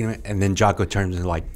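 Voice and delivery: ordinary talk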